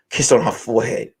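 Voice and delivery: ordinary talk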